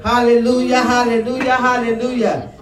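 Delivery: loud, high call to praise; preaching shout; rousing hallelujah. Real, not acted. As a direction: stir the congregation.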